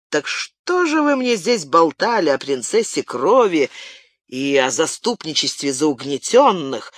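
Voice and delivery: ordinary delivery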